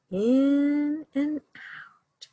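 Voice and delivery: very slow, drawn-out breathing demo; slow deep breath. Shown as a demonstration. Showing the children how to breathe deeply, very slow. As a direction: breathe it in and out slowly for the kids. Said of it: "Can you breathe deep?".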